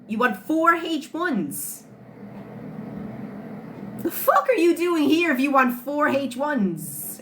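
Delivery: ordinary talk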